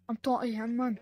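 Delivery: ordinary talk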